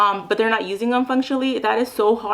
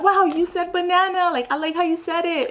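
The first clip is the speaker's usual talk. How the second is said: much higher, delighted praise; much higher, gushing praise for a child; excited praise; 9 semitones higher than usual. Shown as a demonstration. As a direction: thrill at the child's first word. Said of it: "you'll be like".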